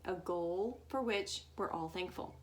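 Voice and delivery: ordinary delivery, nothing marked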